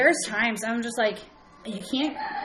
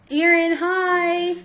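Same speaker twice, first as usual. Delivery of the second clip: bright greeting; 8 semitones higher, 5 dB louder, much slower than usual. Real, not acted. Greeting a live viewer.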